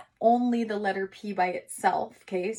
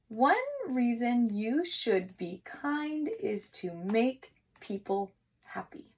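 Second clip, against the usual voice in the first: slow, deliberate reread of the sentence; 3 semitones higher, slower than usual. Shown as a demonstration. Teacher rereading the sentence slowly with the class. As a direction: read each word slowly like a good reader checking.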